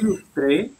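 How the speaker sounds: "illustré" with the final E sounded and stressed, rising; accented final E. Shown as a demonstration. Teacher corrects the student's reading, accented final E. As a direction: end "illustré" on a clear, lifted French é. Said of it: "porque hay un acento en la E".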